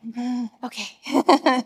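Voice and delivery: slow, hesitant mm-okay; reluctant okay. Retold or imitated. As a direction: give in without wanting to. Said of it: "I'm like".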